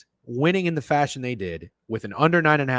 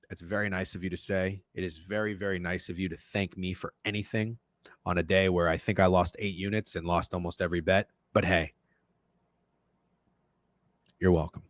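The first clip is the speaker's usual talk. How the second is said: sarcastic; 8 semitones lower, 6 dB quieter than usual. Real, not acted. Sarcastic reply to chat thanks.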